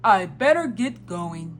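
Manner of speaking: storybook Santa voice; Santa Claus. A narrator's reading. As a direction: put on a Father Christmas voice.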